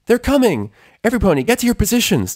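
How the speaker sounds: loud high fast command; rally the room to hide; bellowed. A narrator's reading. Twilight ordering the party ponies to hide, bellowing. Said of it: "Twilight bellowed".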